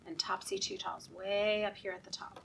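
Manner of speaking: quick whispered aside. Acted. Reader whispering a reminder to the kids. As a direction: tuck it in softly.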